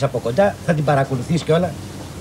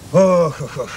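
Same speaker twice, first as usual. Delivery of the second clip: heavy sighs; much slower than usual. Acted. Worried employee beside his colleague, heavy sighing. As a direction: run of troubled sighs, fading away. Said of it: "Τρεις κολλητοί αναστεναγμοί".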